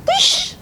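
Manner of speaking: high swooping whoosh; whoosh sound effect. Acted. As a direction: sweep up high like a magic whoosh. Transition into cartoon costume.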